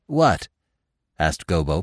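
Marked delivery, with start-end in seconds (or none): 0.1-0.5 high, puzzled query. A narrator's reading. Gobo answering Bambi's cry, puzzled. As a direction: sound briefly baffled.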